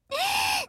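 shrieking laugh. Acted. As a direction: cackle at his weakness. Sister laughing at brother.

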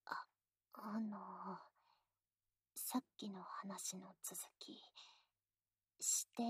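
soft, shy intimate whisper. Acted. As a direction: breathe it close to the ear.

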